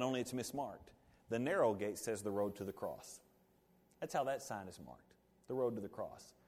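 normal talking voice

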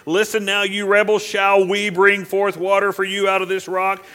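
loud, high rebuke; angry shout. Acted. Moses rebuking the rebels at the rock, shouting. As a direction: bark it at the rebels.

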